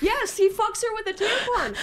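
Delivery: excited. Real, not acted. Insisting, high.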